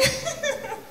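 a high, breathy laugh holding back tears; nervous teary laugh. Real, not acted. Teacher laughing nervously before thanking her seniors. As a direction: laugh off the emotion as you start the speech.

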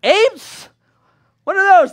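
squeaky high, startled; amazed question. Acted. A townsman first hears of apes, high startled voice. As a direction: gape at something never seen.

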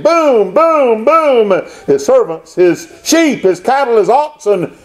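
emphatic, high-pitched sermon delivery; impassioned preaching. Real, not acted. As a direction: pile up the calamities like a preacher. Preaching at full force.

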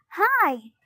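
high, mild yell; high-pitched happy-to-see-you call. A narrator's reading. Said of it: "she yelled".

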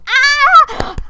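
high, loud squeal of delight; excited scream. Real, not acted. Vlogger squealing as the horse teaser starts. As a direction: let out a thrilled shriek.

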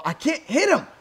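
high wail rising to a loud "no"; frustrated yell. Real, not acted. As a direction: cry out as the fight is lost. Losing the fight, cries out.

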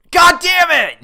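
high, explosive shout of frustration; furious yell. Real, not acted. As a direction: blow up at losing. Failed in game.

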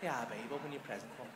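low, very soft and gentle; mock-sweet quiet invitation; sweet coaxing. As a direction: drop to a tender murmur for your partner. Turning sweetly to partner after shouting.

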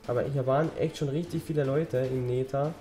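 ordinary talk

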